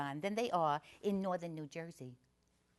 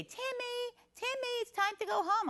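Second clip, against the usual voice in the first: high sing-song calling out; a mom calling her kid from the doorway; calling mother; 13 semitones higher than usual. Retold or imitated. Mother calling her son from outside the restroom, high sing-song. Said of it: "I hear a lady say".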